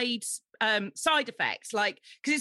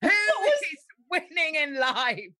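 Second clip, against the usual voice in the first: loud and higher, laughing through it; laughing shout; 6 semitones higher, 3 dB louder, slower than usual. Real, not acted. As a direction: call it out teasingly, half laughing. Joking challenge, laughing.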